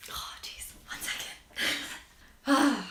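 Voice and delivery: whispered oh jeez to herself; a breathy muttered aside; under-breath aside. Real, not acted. Pausing mid-video, whispering to herself. Catches herself, pauses recording.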